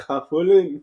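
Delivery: says Opulent in a mock Indian accent, loud. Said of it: "in a Indian accent".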